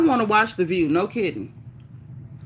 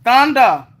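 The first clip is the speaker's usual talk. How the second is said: excited call; 4 semitones higher, 7 dB louder than usual. Real, not acted. Calls out viewer name loudly.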